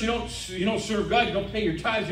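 usual speaking voice

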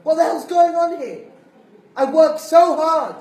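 furious wife yelling at husband; wife shouting. Retold or imitated. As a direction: scold him furiously. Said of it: "she shouts at him".

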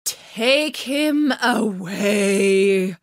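slow, drawn-out bored queen. A narrator's reading. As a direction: drag it out as if utterly bored. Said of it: "in a very, very bored voice".

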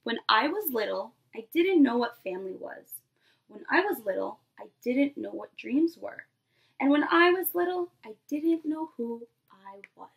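lively picture-book read-aloud to a class; storybook voice for a nursery class; reading to children. Acted. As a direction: read it like story time for little kids. Teacher reads picture book aloud.

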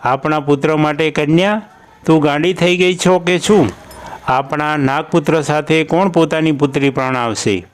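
ordinary delivery, nothing marked

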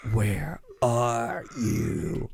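very slow, drawn-out menace; creepy slow voice. Acted. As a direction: stretch the words like a stalker. Creepy caller on the line.

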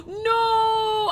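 sharp gasp then a high shouted no; real panic at the forgotten matches; startled gasp and cry. Real, not acted. Player panicking over lost matches, high shout.

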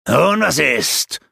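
slow, loud, challenging demand; a wizard's slow taunting challenge. A narrator's reading. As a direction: goad him, drawing each word out. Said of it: "forderte der Zauberer ihn auf".

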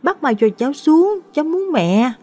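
put-on high, whining little boy; high-pitched child's wail, acted; crying small child. A narrator's reading. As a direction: plead like a tearful toddler wanting mum. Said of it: "Thằng Mít khóc ngoằn ngoại".

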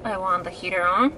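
normal talking voice

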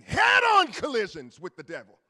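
loud preacher's cry; preaching shout. Real, not acted. Preacher warning the church, shouting. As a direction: preach it out full force.

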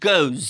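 গোজ ending in a buzzing z sound; goze, with z not s; say goes with z. Shown as a demonstration. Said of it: "তখন হয়".

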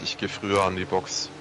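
normal talking voice

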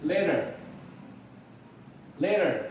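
"later" with the T softened toward a D, drawn out; teacher modelling "later" with a tapped American T. Shown as a demonstration. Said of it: "那美式的发音呢，T啊".

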